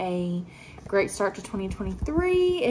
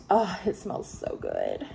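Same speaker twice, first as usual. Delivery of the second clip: ordinary talk, nothing marked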